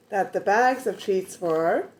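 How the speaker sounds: ordinary delivery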